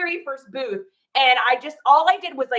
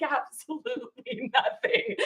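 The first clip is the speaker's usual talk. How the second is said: softer, laughing through the words; laughing, softer brush-off; laughing deadpan; 7 dB quieter, slower than usual. Retold or imitated. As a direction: giggle out the flat no. Deadpan reply to seller.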